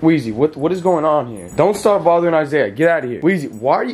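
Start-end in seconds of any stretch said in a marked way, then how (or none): none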